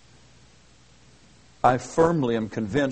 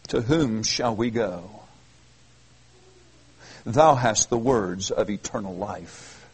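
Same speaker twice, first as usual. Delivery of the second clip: reverent; 4 semitones lower, 4 dB quieter than usual. Acted. Peter's answer, read reverently.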